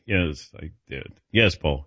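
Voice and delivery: ordinary talk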